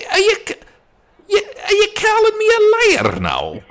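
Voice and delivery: very high, loud, stammering indignation; put-on copper taking offence, dropping at the end; affronted policeman. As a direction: splutter in high-pitched outrage.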